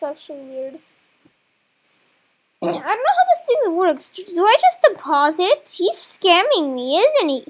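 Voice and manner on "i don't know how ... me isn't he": loud, high, rattled outburst; alarmed and much louder than usual; panicked protest. Real, not acted. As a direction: blurt it out loudly in real alarm. Panicking as he is being scammed.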